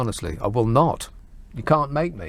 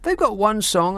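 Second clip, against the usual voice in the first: "They've got one song" pitched higher in exasperation; exasperated repeat; 7 semitones higher than usual. Real, not acted.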